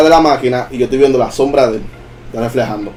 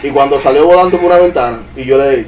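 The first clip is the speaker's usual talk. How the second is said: normal talking voice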